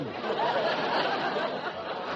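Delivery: high breathy laugh. Real, not acted. Straight man laughs at the little boy prize joke. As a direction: break up mid-sentence.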